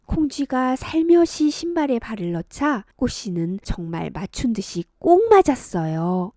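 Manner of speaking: plain reading voice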